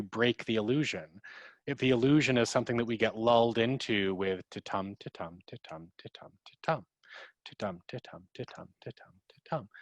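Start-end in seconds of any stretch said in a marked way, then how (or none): 4.5-9.7 much softer, sing-song ta-tum like a lullaby; lulling ta-tum. Shown as a demonstration. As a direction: rock the listener to sleep with the beat. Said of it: "that can be like a lullaby".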